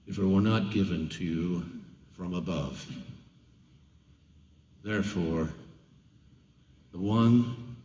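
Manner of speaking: Jesus answering Pilate, low and calm; calm, steady. A narrator's reading. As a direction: speak with quiet authority.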